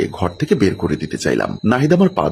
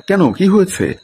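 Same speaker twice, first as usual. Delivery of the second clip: high, startled what's wrong; alarmed question; 10 semitones higher than usual. A narrator's reading. Aunt running in at the shouting, high and alarmed. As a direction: ask in sudden alarm.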